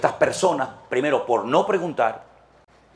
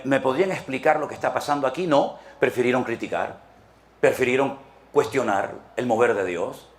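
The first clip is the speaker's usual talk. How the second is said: ordinary delivery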